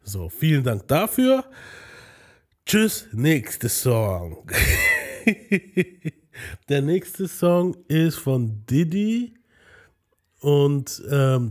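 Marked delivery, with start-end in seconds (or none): 4.5-5.8 a sudden high-pitched laugh; high burst of laughter. Real, not acted. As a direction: cackle at your own joke. Laugh after dismissing song.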